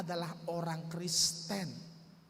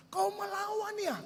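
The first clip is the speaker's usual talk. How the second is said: much higher, sharp mock-angry retort; angry challenge; 12 semitones higher, 3 dB louder than usual. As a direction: throw it back like a furious stranger. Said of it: "Dia marah lagi". (tantangan marah dengan nada jauh lebih tinggi)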